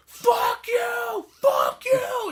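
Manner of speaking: very high, strained mock scream; imitated yelling. Imitating a man yelling as the wire tightens, high mock scream. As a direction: scream it high and loud like a dying film character. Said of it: "the whole time he's yelling".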